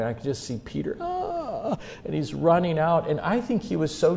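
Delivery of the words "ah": high, soft mock sob; sobbing wail. Peter running out after hurting the Lord, imitated sobbing wail. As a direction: imitate a weeping cry, pitched way up. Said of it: "they're weeping and sobbing".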